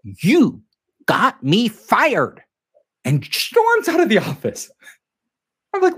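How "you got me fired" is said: stiff anger. Retold or imitated. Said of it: "very rigid".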